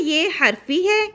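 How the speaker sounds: ordinary talk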